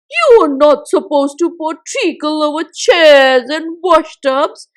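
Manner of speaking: loud, furious scolding of the jug; angry scolding. Acted. Miggle yelling at the jug pouring treacle on his washing. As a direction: tell the naughty jug off at full volume.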